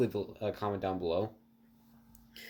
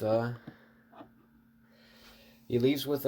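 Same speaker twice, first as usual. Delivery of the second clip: ordinary delivery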